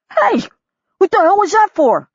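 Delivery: high, loud indignant splutter. A narrator's reading. Soaked photographer yelling up from the pool, indignant. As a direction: shout up from the pool, offended. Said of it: "came up sputtering and talking".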